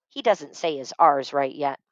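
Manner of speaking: plain reading voice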